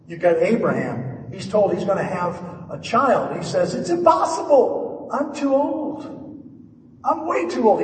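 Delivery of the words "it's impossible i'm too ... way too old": high-pitched, disbelieving mock protest; hammed-up, much higher incredulity; incredulous protest. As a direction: squeal it as an old man who can't believe it. Acting Abraham's disbelief at having a child.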